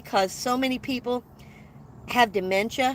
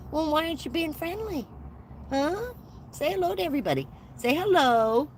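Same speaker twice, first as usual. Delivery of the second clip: coaxing pet talk; 5 semitones higher than usual. Real, not acted. Coaxing her little dog to greet.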